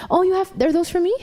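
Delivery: usual speaking voice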